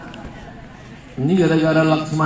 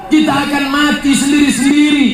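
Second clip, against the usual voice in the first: fiery preaching; 7 semitones higher than usual. Acted. Preacher warns the congregation, fiery preaching. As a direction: thunder the verse at the crowd.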